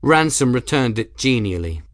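ordinary delivery, nothing marked

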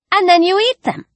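put-on girl's voice, pitched high, eat stressed with a flourish. A narrator's reading. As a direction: Ellie finishing her fish story proudly, high and showy. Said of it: "Ellie finished with a flourish".

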